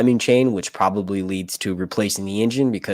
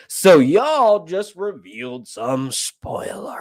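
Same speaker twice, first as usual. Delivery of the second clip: loud, slow, higher, with "spoilers" drawn right out; mock spoiler outcry; 5 semitones higher, 5 dB louder, much slower than usual. Streamer to viewers who gave away the plot, mock accusing. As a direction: stretch it into a playful loud accusation.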